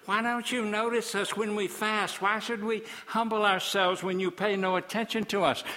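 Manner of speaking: high whiny mimicry of the people; mock-whining complaint, pitched high; mocking whiners. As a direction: whine it back at them in mockery. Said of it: "God says, "You whiners".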